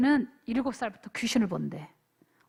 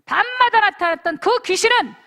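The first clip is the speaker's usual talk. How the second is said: loud, higher authoritative command; commanding exorcism; 5 semitones higher, 8 dB louder than usual. Retold or imitated. Pastor retelling how he cast the demon out of the boy, commanding. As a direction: order the spirit out in Jesus' name. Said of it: "라고 얘기했어".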